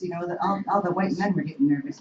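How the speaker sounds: ordinary talk, nothing marked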